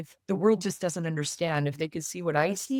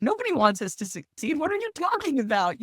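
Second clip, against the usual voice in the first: incredulous retort; 10 semitones higher than usual. Retold or imitated. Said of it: "And they look at me like".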